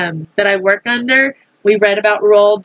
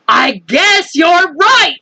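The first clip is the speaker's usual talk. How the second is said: high, slow excited agreement; agreeing; 7 semitones higher, 3 dB louder, slower than usual. A narrator's reading. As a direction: go along with the chief. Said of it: "said the head of the poli".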